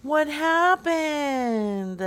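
high, very slow, drawn-out wail; put-on dismay. Prank caller asking how Brenda died, put-on dismay. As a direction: stretch it out in hammed-up grief. Feigned grief at news of death.

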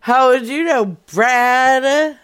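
loud laughing protest; mock outrage. Real, not acted. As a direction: yell it in fake offense.

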